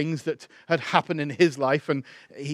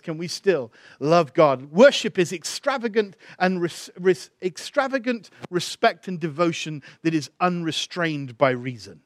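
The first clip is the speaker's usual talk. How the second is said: normal talking voice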